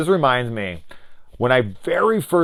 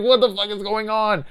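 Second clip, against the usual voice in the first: high, exasperated mock panic; freaking out; 13 semitones higher, 3 dB louder than usual. Him retelling being stalked at work, high freaked-out outcry. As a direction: reenact bewildered alarm high in the voice. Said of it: "Like, I was freaking out".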